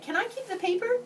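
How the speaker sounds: put-on high, hopeful little boy ask; eager child. A narrator's reading. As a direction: plead brightly, way up high.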